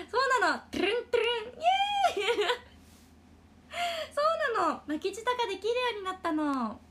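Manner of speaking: high, loud, giddy pride; excited. Real, not acted. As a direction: squeal with proud joy. Proud of learning rolled r.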